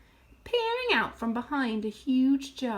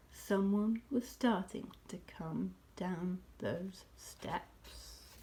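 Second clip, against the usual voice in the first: slow, soft, fading to a whisper; suspenseful hush; 6 semitones lower, 8 dB quieter, slower than usual. A narrator's reading. Narrator ending on a cliffhanger, slow hushed suspense. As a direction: drop to a hushed crawl. Cliffhanger, someone descends to hiding animals.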